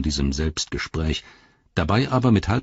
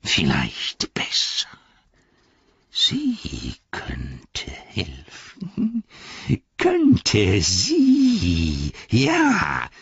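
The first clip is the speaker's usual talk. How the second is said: Gollum voice; much slower than usual. Acted.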